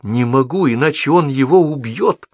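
high, anxious insistence, building; frightened pleading. A narrator's reading. Девочка боится уснуть, frightened pleading. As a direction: plead in fright, pitch climbing. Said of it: "испуганно повторяла девочка".

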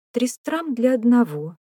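high-pitched woman's voice; prompt female reply. A narrator's reading. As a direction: answer without hesitation, voice lifted. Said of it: "без заминки ответила Беатрис Джоанна".

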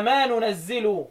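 slow ننزل as "nunazzilu"; n first, "zi" in ننزل, drawn out; nun and kasra in ننزل. Shown as a demonstration. Teacher applies the rule just quoted, slow nunazzilu for ننزل. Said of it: "وبالنون فيها واكسر الزاي".

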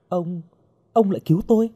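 hesitant, high-pitched question; steady yourself after a fright, disbelieving; shaken, composing herself. A narrator's reading. Rescued girl questioning the masked man, shaken. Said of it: "cô cố trấn tĩnh".

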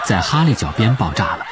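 ordinary delivery, nothing marked

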